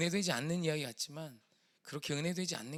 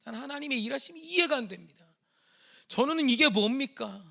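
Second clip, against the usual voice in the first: high, loud wailing plea to God; re-enacted desperate cry, climbing higher and louder; anguished outcry; 8 semitones higher, 13 dB louder than usual. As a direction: build from protest to full-voiced screaming prayer. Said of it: "절규를 했어요".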